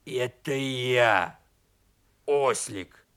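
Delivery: slow, low donkey-turned-wolf voice; low, slow creature announcing himself; strange beastly voice. Acted. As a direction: answer in a low, drawn-out beast voice. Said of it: "удивился своему голосу".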